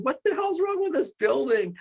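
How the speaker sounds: high-pitched incredulous outcry; retold exasperated disbelief. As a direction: relive the bewildered outburst, pitch shooting up. Retelling his alarm at the haunting.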